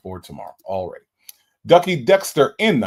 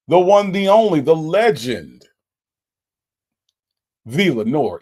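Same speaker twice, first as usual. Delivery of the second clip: hype intro; 4 semitones higher, 6 dB louder than usual. Real, not acted. Hyping a viewer's arrival.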